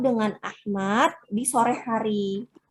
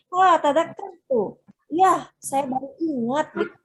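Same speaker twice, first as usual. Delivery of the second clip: raised, exclaiming "wah" of sudden recall; exclamation-mark intonation; 5 semitones higher than usual. Shown as a demonstration. Teacher demonstrates Ahmad's line, exclaiming as he suddenly remembers. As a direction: read it with an audible exclamation mark. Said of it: "berarti dia intonasinya seperti itu ya".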